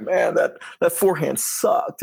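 mock self-disgust, pitch swinging; frustrated inner voice. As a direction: grumble about a bad shot. Inner voice after a bad point.

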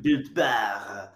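a hint of French Canadian, Built Bar laughed out; bad French Canadian accent. Joking about the Canadian spelling, says it in a mock French Canadian accent. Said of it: "my horrible French Canadian accent".

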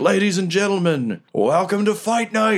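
high, booming fight-night announcer; ring announcer. Acted. GM as Riker opening the fight to the crowd, announcer voice. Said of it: "And he's like".

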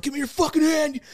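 high urgent demand; urgent shout. Retold or imitated. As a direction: demand his hand in the dark. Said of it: "He's like".

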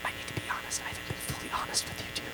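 fast confessional whisper. Acted.

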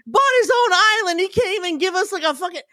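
high shouting rant that fades off; retold angry scream. Boss shouting about the rich donor's island, high mock yell. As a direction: yell it high, then trail away. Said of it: "he starts screaming about it".

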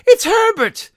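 put-on shrieking old woman, very high; frantic high-pitched shriek; scream. A narrator's reading. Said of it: "she screamed".

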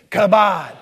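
loud chant on the count of three; leading a crowd in one word; group call-out. Shown as a demonstration. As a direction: call out "kabod" loudly for all to join. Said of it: "why don't we say kabod together".

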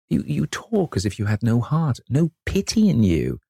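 hurt reproach. A narrator's reading. Basil reproaches a changed Dorian.

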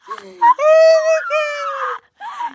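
shrieking with laughter; cracking up in disbelief; laughing hard. Real, not acted. Laughing at friend's scarf.

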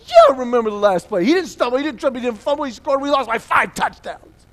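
high, full-volume angry rant; acted kid screaming the facts at dad; snapping back. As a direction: rattle off each failure in an outraged yell. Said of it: "Wrong question".

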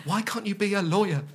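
high, loud mock-parent wail; nagging parent. Mocking a parent's complaint about a comedy career, high nagging parent voice. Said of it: "I don't have the old".

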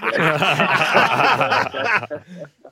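long loud burst of laughter; big laugh. Real, not acted. Player laughing about his English wife's explanation, loud hearty laugh. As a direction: crack up at the story.

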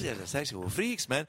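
repeating his bad Mexican accent back at him; mocking Mexican accent. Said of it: "Pepe Le Pew's Mexican best friend".

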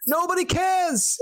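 high, slow yell from far off; distant neighbour yell. Retold or imitated. Movie neighbour yelling from the background, high slow yell. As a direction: call it out like a neighbour down the street.

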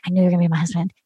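low, hushed lovestruck whisper; mock whisper. [name] confesses love to Dale, mock whisper. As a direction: whisper it as a put-on intimate confession.

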